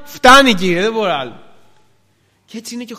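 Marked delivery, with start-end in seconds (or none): 0.0-1.4 loud cry of giving up, falling off; acted plea of someone at the limit; begging to stop. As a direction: burst out, then let it drop. Exhausted marcher begs to stop.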